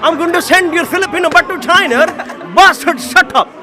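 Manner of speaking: loud laughing trash talk; laughing taunt. Real, not acted. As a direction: cackle while you jab at him.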